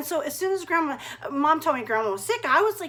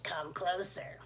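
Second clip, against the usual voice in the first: low, soft beckoning; luring wolf-granny; 8 semitones lower, 14 dB quieter, slower than usual. Acted. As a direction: draw her closer, hushed and low. Disguised wolf in granny's bed.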